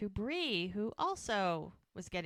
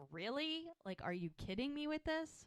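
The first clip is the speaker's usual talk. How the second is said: higher, softer, mock-incredulous questioning; half-laughing disbelief; 8 semitones higher, 5 dB quieter than usual. Bree shaking her head at Brady, lightly voiced incredulity. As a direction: imitate a disbelieving scoff, pitched up. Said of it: "kinda laughing".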